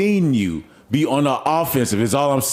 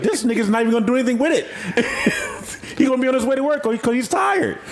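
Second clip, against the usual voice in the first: laughing; 8 semitones higher, faster than usual. Retold or imitated. Mocking friend's indifference, laughing.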